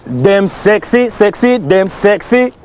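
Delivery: hyped-up Jamaican dancehall patois; shout-outs in a drawn-out Jamaican accent. Acted. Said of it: "chill out with that Jamaican accent".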